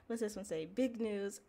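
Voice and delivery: ordinary talk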